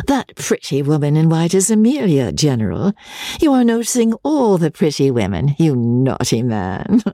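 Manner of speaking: high, playful lady's lilt; high flirtatious scolding; teasing society lady. Acted. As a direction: tease the General coquettishly in a high voice.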